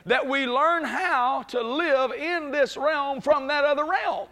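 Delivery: preacher rising to a shouting peak; preaching at full force. Acted. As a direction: lift the church with each phrase. Preaching climax with congregation answering.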